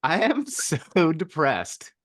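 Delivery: slow, high, laughing while forcing out sad words; laughing through it. Pretend. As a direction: try to sound intense but crack up smiling. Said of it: "say with as much intensity as you can muster".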